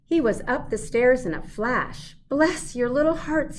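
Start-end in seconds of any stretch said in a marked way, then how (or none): 2.3-3.6 cried out. A narrator's reading. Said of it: "he cried".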